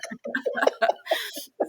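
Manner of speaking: very high unvoiced laugh; high breathless laugh. Real, not acted. Teller laughing at her mother's forgetting, high and breathless. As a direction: laugh off the absurdity.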